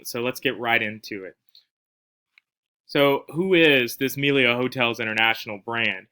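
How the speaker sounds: ordinary delivery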